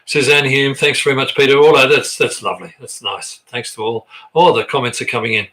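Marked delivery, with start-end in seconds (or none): none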